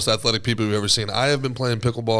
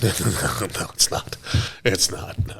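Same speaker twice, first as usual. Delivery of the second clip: quick laughing string of denials, lower voice; dismissive Boca people; 5 semitones lower than usual. Retold or imitated. Host imitating Boca people rejecting the comparison, lower, chuckling denial. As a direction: brush it off under a low chuckle. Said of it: "and they're like".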